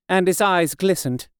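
plain reading voice

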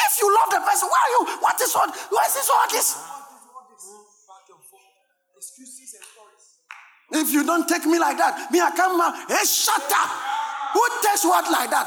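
loud, high, shouted sermon delivery; fiery Ghanaian pulpit shouting; full-force preaching. Acted. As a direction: preach it hard at the congregation.

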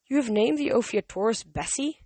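can't believe the name; incredulous father. A narrator's reading. Poseidon incredulous.

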